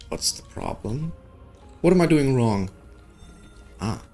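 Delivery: ordinary talk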